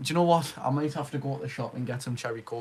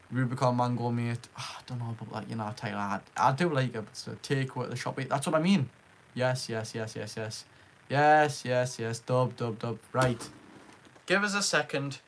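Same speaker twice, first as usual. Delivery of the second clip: usual speaking voice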